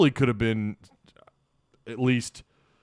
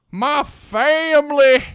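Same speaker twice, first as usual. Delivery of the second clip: high, slow put-on Southern accent drawl; fake Southern accent; 9 dB louder, much slower than usual. Someone fakes a Southern accent on stream, in a high slow hammed-up Southern accent. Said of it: "fake a Southern accent".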